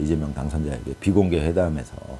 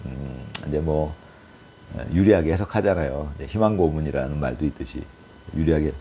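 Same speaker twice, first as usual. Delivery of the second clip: ordinary talk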